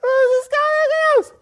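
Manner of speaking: squeaky outraged demo of an uptight voice. Shown as a demonstration. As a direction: push the voice as high as it goes, uptight. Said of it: "your voice goes higher".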